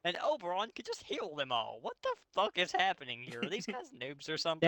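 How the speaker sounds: can't believe how badly these heroes play; exasperated disbelief. Real, not acted. Mocking the fic's weak heroes.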